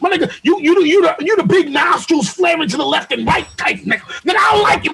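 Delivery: high-pitched, hammed-up angry rant; mock insult tirade. Acts out a hypothetical insulting tirade.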